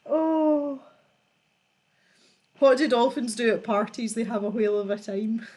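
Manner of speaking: ordinary delivery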